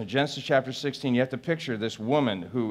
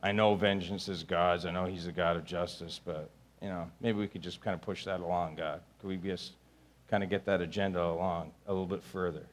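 much softer, lower, sneaky request; sheepish prayer; 6 semitones lower, 8 dB quieter than usual. Acted. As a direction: murmur it as if bargaining with God. Sheepish quiet prayer for revenge.

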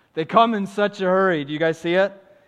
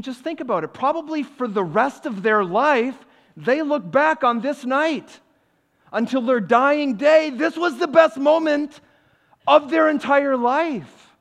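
rising passionate preaching; 9 semitones higher, 6 dB louder than usual. Real, not acted. Building preaching climax.

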